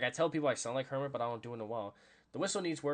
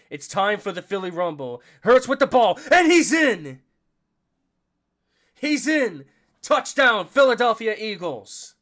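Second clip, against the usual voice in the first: loud, high play-by-play shout; excited touchdown call; 8 semitones higher, 14 dB louder than usual. Real, not acted. Streamer calling an Eagles touchdown to viewers, excited shout. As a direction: belt out the score as it happens.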